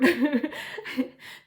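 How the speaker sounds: loud, high laugh bursting out; real burst of laughter. Real, not acted. Reader laughing hard at her own quote.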